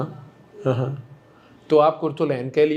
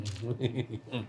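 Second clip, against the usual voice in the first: low, soft chuckle; 5 semitones lower, 14 dB quieter than usual. Real, not acted. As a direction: laugh quietly at yourself. Joking about his angry face.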